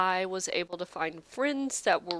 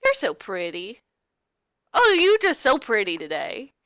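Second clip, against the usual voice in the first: backhanded compliment; 3 semitones higher, 6 dB louder than usual. Retold or imitated. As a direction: gush sweetly while meaning the opposite. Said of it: "it's like".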